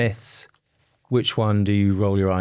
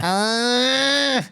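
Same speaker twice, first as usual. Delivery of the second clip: high screechy witch wail; witch screech; 12 semitones higher than usual. Retold or imitated. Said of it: "sounds like a witch".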